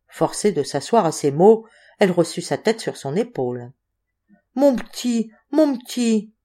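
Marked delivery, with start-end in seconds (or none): 4.6-6.3 higher-pitched, tender murmur of affection; moved tenderness. A narrator's reading. Woman holding boy's head on her shoulder, moved tenderness. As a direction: coo it to him, pitched up.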